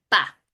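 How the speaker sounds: pa sounded out as one blended syllable; pa as a demo blend. Shown as a demonstration. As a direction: join p and a into pa. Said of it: "La p con la a".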